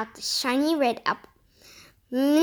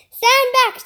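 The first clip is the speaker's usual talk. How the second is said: raised, higher-pitched storybook yell; 8 semitones higher, 5 dB louder than usual. A narrator's reading. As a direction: call it out loud and high, as a warning. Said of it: "she yelled".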